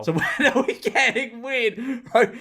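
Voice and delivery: high, laughing read-out; real laughter. Real, not acted. Reading a bad dating-app opener aloud, cracking up.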